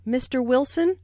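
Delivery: "Mister Wilson" with the voice going up; addressing someone, "Wilson" lifted; rising intonation. Shown as a demonstration. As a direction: let "Wilson" rise at the end. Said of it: "Notice the rising intonation on".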